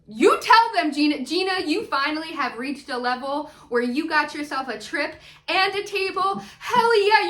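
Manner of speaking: loud, high-pitched cheering; excited hype. Real, not acted. Host egging Gina on about her table, excited.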